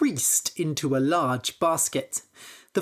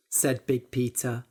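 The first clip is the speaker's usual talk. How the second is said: ordinary delivery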